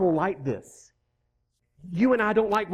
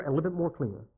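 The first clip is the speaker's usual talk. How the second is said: softer, fading; 3 semitones lower, 5 dB quieter, faster than usual. Shown as a demonstration. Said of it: "getting a little bit more clear".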